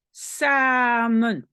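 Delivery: "Samen" with the a held long; open-syllable demo. Shown as a demonstration. Teacher shows learners an open syllable, drawn-out demo. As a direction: stretch "Sa", then land on "men". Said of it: "syllabe ouverte".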